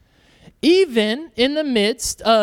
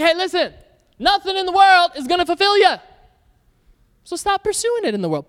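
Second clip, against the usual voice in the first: urgent exhortation; 8 semitones higher, faster than usual. Retold or imitated. Acts out urging people toward Jesus.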